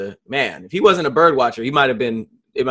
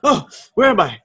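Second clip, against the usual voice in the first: dazed dog; 5 semitones higher than usual. Pretend. Voicing the passed-out dog.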